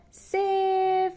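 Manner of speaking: put-on gleeful kid's yell. A narrator's reading. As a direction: call it out as you slap the base. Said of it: "yelled out".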